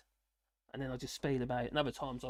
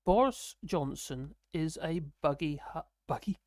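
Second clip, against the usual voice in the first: slow, deliberate dictation voice; robot-like dictation; 3 dB louder, slower than usual. Shown as a demonstration. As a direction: dictate word by word for voice typing. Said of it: "you have to talk like a robot".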